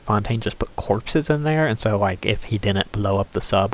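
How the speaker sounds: usual speaking voice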